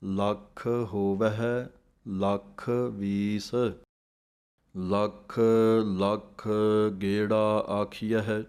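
slow, measured chant of scripture, each word drawn out; slow Gurbani recitation. Shown as a demonstration. Teacher recites the verse for students, slow chant.

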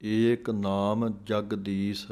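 slow ਏਕ ਨਾਮ Jagdish after a pause, ਏਕ stressed; pause, then set off ਏਕ ਨਾਮ Jagdish slowly; pause before it. Shown as a demonstration. Teacher marks the pause before ਏਕ ਨਾਮ Jagdish, stressed ਏਕ. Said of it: "ਇੱਥੇ ਵਿਸਰਾਮ ਹੈ".

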